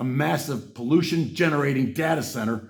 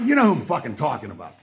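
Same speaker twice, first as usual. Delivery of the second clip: normal talking voice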